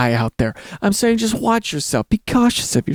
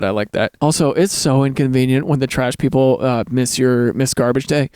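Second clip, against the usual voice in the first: normal talking voice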